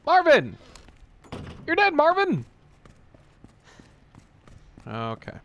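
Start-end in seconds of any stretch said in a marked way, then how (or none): 0.0-2.4 high, loud holler; mock yelling. Player calls to the dead Marvin, yelling. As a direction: holler it at someone across the room. Calling out to game character.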